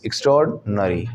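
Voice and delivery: "एक्स्ट्राऑर्डिनरी" as ik-STRORD-nuh-ree; corrected pronunciation. Shown as a demonstration. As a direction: say "एक्स्ट्राऑर्डिनरी" with extra and or merged. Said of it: "इस तरीके से आपको बोलना है".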